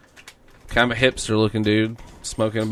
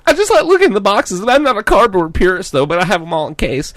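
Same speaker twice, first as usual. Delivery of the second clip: much higher, louder, fast bro voice; excited bro; 8 semitones higher, 4 dB louder than usual. Retold or imitated. Quoting the clerk, high fast gushing bro voice. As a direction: gush about Ernie, rushing.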